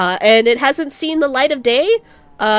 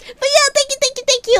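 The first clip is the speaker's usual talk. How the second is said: gushing thanks; 12 semitones higher than usual. Real, not acted.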